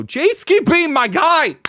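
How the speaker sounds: very loud, high greeting yell; over-excited, high-pitched shout-out; hyped shout. Real, not acted. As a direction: holler it like greeting a favorite buddy. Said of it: "I shouldn't have done that".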